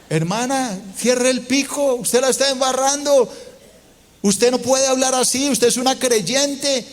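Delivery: a hammed-up older sister telling the complainer to shut her beak; stern sister rebuking. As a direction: set her straight from above.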